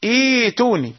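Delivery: إيتوني begun with a long ee; no catch before the t in إيتوني; eased second hamza. Shown as a demonstration. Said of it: "تقرأ".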